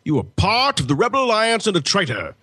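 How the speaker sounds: mock-commanding accusation; Darth Vader. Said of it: "when Vader says".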